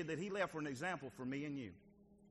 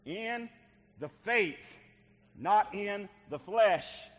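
call and response; 3 semitones higher, 8 dB louder, much slower than usual. Real, not acted. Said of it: "Say it with me".

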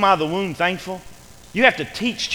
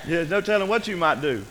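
ordinary talk

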